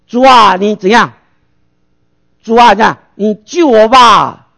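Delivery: a loud, pleading cry to the Lord, slowing to a drawn-out end; desperate loud prayer, last words stretched slow; loud cry to God. Retold or imitated. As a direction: call out to God for rescue. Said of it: "你大声跟神说".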